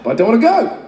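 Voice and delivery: put-on high, loud whiny refusal, like a sulking child.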